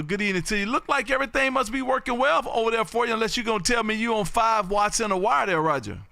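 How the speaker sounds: ordinary delivery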